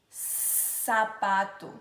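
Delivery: "sapato" with long hissed opening s; S sound demonstration. Shown as a demonstration. Teacher giving an example of S, "sapato" with held hiss. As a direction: draw out the first S of "sapato", slowly. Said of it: "nós temos o S".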